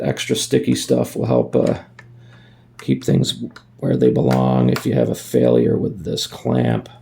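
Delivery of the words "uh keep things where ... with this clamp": normal talking voice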